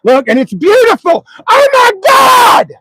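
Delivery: fake-sob with overwhelmed delight; mock tearful squeal at a gift; mock crying joy. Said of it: "Oh, here, I'm opening it".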